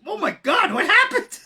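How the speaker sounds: high, loud mock horror; shocked wife. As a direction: gasp it like a shocked Parisian. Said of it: "she's looking at us like".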